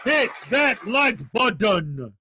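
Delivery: "button" said as "budden", a hard D in the middle, slow and drawn out; mocking Chili. Streamer to viewers, slowly mocking Chili with "button" said as "budden". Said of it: "accentuate the D so that you know I'm making fun of Chili".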